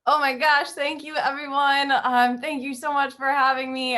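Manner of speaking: excited thanks. Real, not acted. Guest poet thanking everyone for having her, excited.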